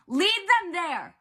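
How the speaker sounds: slow, high, full-force yell; desperate shout. A narrator's reading. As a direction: shout the order with all your might. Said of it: "Percy shouted".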